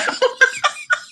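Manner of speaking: sudden high laugh breaking out; delighted high-pitched laugh; high burst of laughter. Real, not acted. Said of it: "It was so funny".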